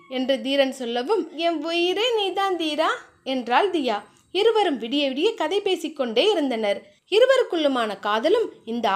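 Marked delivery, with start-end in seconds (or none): 1.5-2.9 slow, tender, higher woman's voice. A narrator's reading. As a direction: say it slowly like a vow. Diya's tender reply.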